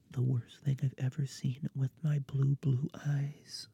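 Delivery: breathy dramatic whisper. Real, not acted. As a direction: hush it like a dark secret. Mock-dramatic disgust at revival.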